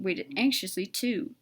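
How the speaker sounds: ordinary delivery, nothing marked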